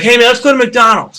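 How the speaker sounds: playing the hammered buddy with a sudden craving; drunk bandmate. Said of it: "He's like".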